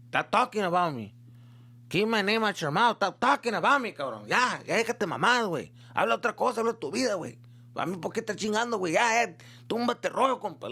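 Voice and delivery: imitating angry dad. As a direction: play the dad telling him to knock it off. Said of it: "my dad's looking at me like".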